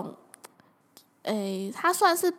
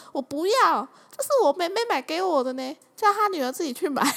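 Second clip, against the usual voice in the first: higher, stubborn mom voice; 6 semitones higher than usual. Retold or imitated. Mom refusing to give the neighbor a mask. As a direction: refuse like a possessive mother.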